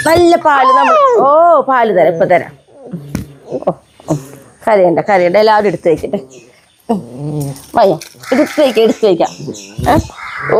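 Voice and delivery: calm them, promise milk; soothing baby talk. Acted. Owner soothing crying puppies, cooing baby talk.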